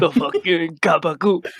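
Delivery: mock Italian-American mobster accent; Sopranos impression. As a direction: echo the line as a hammed-up Italian-American gangster. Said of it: "outtake from The Sopranos".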